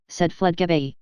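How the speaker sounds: usual speaking voice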